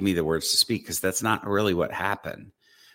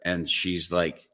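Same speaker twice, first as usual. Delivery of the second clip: ordinary talk, nothing marked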